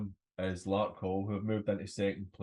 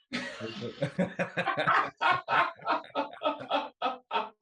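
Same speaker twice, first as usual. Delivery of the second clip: long, high, breathy cackle; 13 semitones higher, 4 dB louder than usual. Real, not acted. Laughing at the league table, a long high cackle. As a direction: let out a wheezing laugh.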